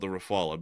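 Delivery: Rafale said ruh-FAH-luh, American R; Americanized French pronunciation. Shown as a demonstration. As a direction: say Rafale in three syllables, ending on a soft uh. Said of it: "because I'm an American".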